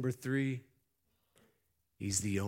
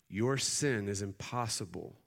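ordinary talk, nothing marked

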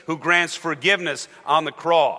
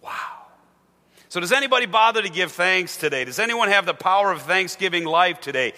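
ordinary delivery

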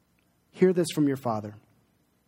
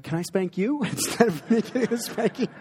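daughter asking with a laugh; 6 semitones higher than usual. Retold or imitated. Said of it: "she's like".

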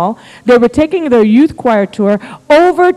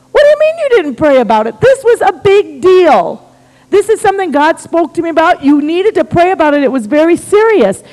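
high, emphatic mock outrage; incredulous scolding; 7 semitones higher, 3 dB louder than usual. Husband appalled that wife didn't pray, incredulous scolding. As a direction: start fast and high, then lean on each point.

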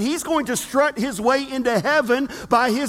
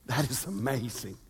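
hushed wonder; 14 semitones lower, 13 dB quieter than usual. Real, not acted. Repeated, soft then plain.

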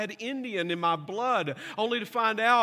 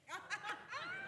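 soft breathy laugh; quiet chuckle; 12 semitones higher, 16 dB quieter than usual. Real, not acted. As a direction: chuckle at the tease. Laugh at own joke.

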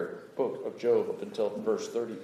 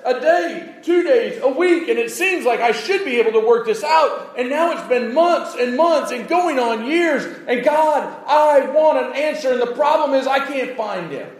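much higher, louder pleading cry as Job; impassioned preaching; 10 dB louder than usual. Acted. Preacher voicing Job crying out to God, impassioned. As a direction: shout Job's demand to God.

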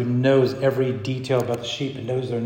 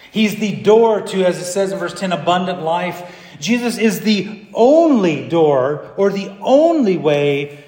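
higher, emphatic pulpit voice; forceful preaching; 6 semitones higher, 6 dB louder than usual. Real, not acted. Preacher tells the church about the door, forceful proclamation. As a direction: drive home each claim to the congregation.

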